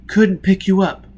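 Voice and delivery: high, loud, pained admission; strained confession. A narrator's reading. Heartless man confesses to Foyle, pained. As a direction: push the words out in pain.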